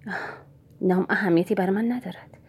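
plain reading voice

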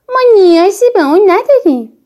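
put-on loud, high child's objection; high-pitched, forceful refusal; defiant protest. A narrator's reading. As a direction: burst out with it, refusing the idea. Child finally objects to mother's plan.

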